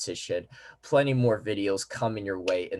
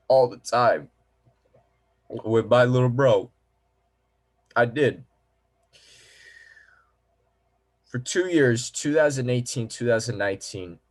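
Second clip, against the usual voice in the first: louder, higher, welling up with real emotion; emotional; 4 semitones higher, 6 dB louder than usual. Real, not acted. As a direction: voice swelling as the memory hits. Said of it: "if I'm getting emotional here".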